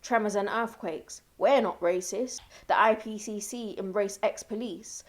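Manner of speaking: ordinary delivery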